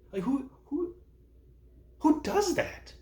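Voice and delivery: exasperated. Retold or imitated. Exasperated rhetorical question.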